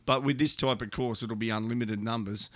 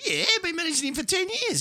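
bluffing boast. Retold or imitated. Bluffing agent on the phone.

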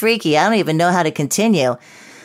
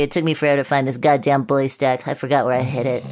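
ordinary talk

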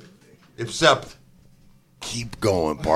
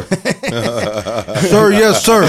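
recruit shouting back; 5 semitones higher, much slower than usual. Pretend. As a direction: sound off like a recruit. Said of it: "And the drill sergeant".

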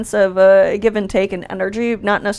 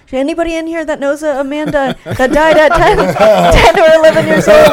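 louder, higher plea to the room; medium calling out; 5 semitones higher, 5 dB louder than usual. Acted. A medium asking the audience for a match, calling out. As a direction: call out to a crowd as a fishing medium. Acting a medium fishing for responses.

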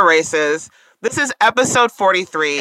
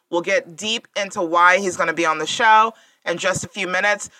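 usual speaking voice

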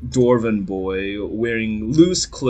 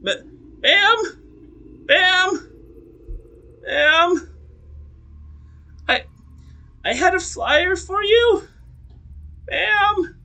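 put-on much higher, timid young dwarf boy; nervous boy voice; slower than usual. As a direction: knock and call out shyly.

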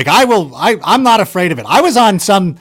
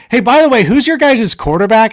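teasing jab; 6 semitones higher than usual. Retold or imitated. Aaron Rodgers teasing a Bears fan.

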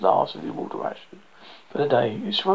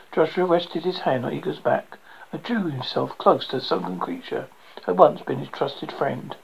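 ordinary delivery

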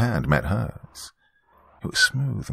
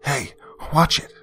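high, startled-sounding yell, not full volume; yelled warning; 8 semitones higher than usual. A narrator's reading. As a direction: call out a sharp, high warning. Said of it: "a voice that yelled out".

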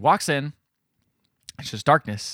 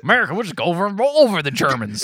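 boastful mock yokel; 7 semitones higher, 5 dB louder, faster than usual. Bragging about beating the Germans, mock yokel voice. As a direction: rattle off a hammed-up patriotic boast. Mock dumb American riff.

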